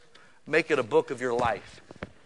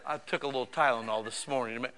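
mock smug; 4 semitones lower, 10 dB quieter than usual. Mocking believer.

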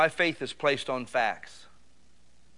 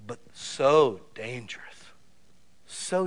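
slow, hushed whisper; whispered warning; slower than usual. Acted. Preacher warning the room in a slow whisper. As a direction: lean in and breathe the warning.

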